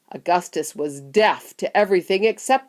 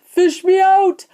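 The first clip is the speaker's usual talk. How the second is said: high, slow, loud plea; desperate drawn-out yell; 12 semitones higher, 9 dB louder, slower than usual. A narrator's reading. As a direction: yell to be rescued from the river. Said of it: "he yelled".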